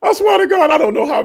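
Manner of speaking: very high, through a laugh; laughing insistence. Real, not acted. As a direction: swear it while cracking up.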